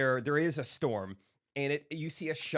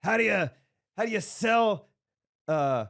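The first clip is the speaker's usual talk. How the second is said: loud joke setup; 5 semitones higher, 5 dB louder than usual. Retold or imitated. Fan telling joke loudly.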